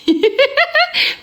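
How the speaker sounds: high-pitched burst of giggling; high giggle. Real, not acted. Laughing at herself on the live, high giggle. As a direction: squeal with laughter.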